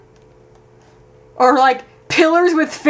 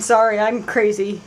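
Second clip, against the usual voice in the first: ordinary delivery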